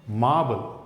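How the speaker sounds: मार्बल with silent r, said mabəl; मार्बल: long aa, no r sound; model pronunciation. Shown as a demonstration. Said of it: "इनको practice करें". (मार्बल का उच्चारण बिना र के)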